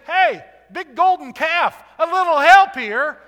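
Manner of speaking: loud, high, sarcastic call to the calf; mock him calling on a useless idol; preacher mocking the king's idol-praying; 1 mocking plea.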